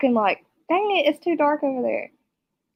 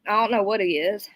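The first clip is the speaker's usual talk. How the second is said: ordinary delivery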